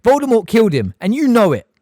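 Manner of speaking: loud, high angry cry; loud accusation. A narrator's reading. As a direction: fling the word at her. Harry shouts at Umbridge.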